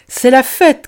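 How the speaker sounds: loud, high party cry; joyful shout. Acted. Boustru announcing the party to the children, loud and high. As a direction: shout it with delight. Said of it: "cria-t-il".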